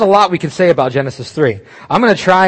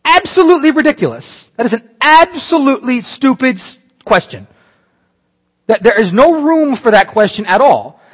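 high, loud and scornful; incredulous outburst; 8 semitones higher, 5 dB louder than usual. Real, not acted. Preacher scorning the serpent's question to the congregation, loud and incredulous. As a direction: scoff at how absurd the question is.

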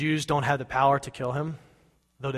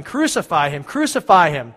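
louder and higher with each repeat; angry mob, yelling louder each time; crowd shouting; 4 semitones higher, 10 dB louder than usual. Acted. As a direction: chant it at him like an angry mob. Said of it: "began shouting".

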